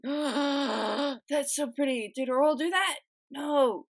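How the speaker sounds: long gasp, then high excited gushing; delighted gasp. Real, not acted. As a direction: gasp and marvel at something pretty. Delighted by a pretty artwork.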